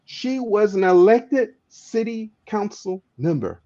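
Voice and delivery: slow, deliberate phrase with "elected" punched; emphatic stress on "elected". Real, not acted. Speaker reveals the woman's office, emphatic stress on "elected". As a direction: spell it out slowly, weight on "elected". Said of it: "I wanna put emphasis on this".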